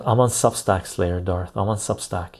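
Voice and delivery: ordinary delivery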